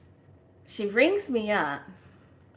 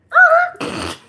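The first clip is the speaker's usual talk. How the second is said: very high, loud delighted shriek; excited squeal; 16 dB louder than usual. Retold or imitated. Spotting the candy shop while driving, a delighted squeal. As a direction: squeal with joy at the sight.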